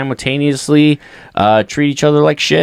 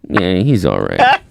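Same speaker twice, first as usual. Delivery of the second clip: grudging dad; 7 semitones lower, slower than usual. Retold or imitated. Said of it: "literally said".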